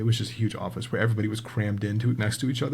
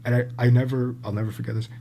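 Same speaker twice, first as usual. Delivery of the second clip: normal talking voice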